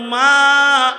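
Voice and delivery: long, loud, high call of MA; retold judge's ringing call; calling out to mother.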